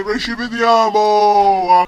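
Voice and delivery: high, loud, slow drawn-out cry; panicked shout. Pretend. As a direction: yell as the plane falls. Crash shout.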